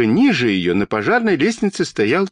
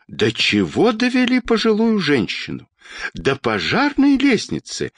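high-pitched outcry from a tall woman; indignant cry; 9 semitones higher than usual. A narrator's reading. Woman in a crowd protesting about an old lady, crying out. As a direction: cry out high, without much extra volume. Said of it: "закричала высокая тётя".

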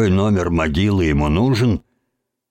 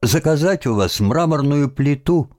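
put-on higher-pitched, politely asking boy; polite request; 6 semitones higher than usual. A narrator's reading. Boy asking the cemetery watchman for a headstone, polite. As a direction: ask courteously, lifting the pitch. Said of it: "вежливо спросил он сторожа".

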